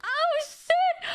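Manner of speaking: sudden high yelp of surprise; startled exclamation. Real, not acted. Caught off guard on stream, yelps in surprise. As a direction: jolt up high on oh shit.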